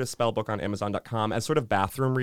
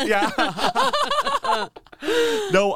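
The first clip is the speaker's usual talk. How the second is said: normal talking voice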